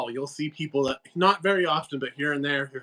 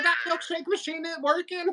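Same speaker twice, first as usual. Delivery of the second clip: put-on Southern accent; 13 semitones higher, 4 dB quieter than usual. In the gas station, laughing put-on Southern accent. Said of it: "I was like".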